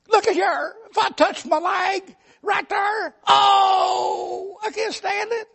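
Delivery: high, tearful whine about pain; whimpering hypochondriac. Retold or imitated. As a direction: whine like every touch is agony. Arzy moaning in pain.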